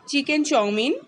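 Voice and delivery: normal talking voice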